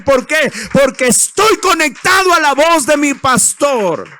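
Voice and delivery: loud, rising preacher's cry, building to a peak; full-force preaching. Acted. Pastor crying out to the church, full-force preaching. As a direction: preach it out to the congregation, fired up.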